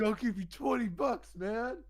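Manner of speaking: high-pitched mock haggling; imitated bargaining plea. Drunk buddy offers masseur cash, mock pleading.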